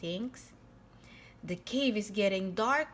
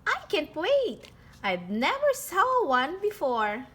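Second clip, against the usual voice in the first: put-on higher, eager kid-cat voice; bubbling over with anticipation; can't wait to dive in; 5 semitones higher, 3 dB louder than usual. Pete eager to see seahorse.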